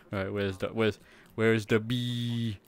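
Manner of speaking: ordinary talk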